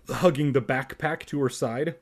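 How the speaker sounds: ordinary delivery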